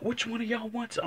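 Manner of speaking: high taunting call; taunting the dogs. Retold or imitated. Man daring two pit bulls, high taunt. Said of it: "I'm talking to both of them".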